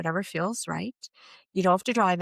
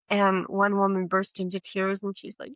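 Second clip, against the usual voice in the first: ordinary delivery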